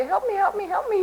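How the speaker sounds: high, pleading little cries of help me; mock-whiny plea to God, pitched high; desperate praying. Retelling her rushed daytime prayers to God, high and whiny. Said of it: "my prayers during the day are kinda like".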